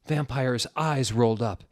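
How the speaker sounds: ordinary delivery, nothing marked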